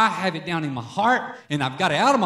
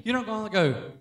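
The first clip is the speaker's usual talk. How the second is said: ordinary talk, nothing marked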